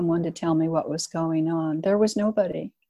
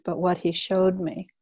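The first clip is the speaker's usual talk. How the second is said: normal talking voice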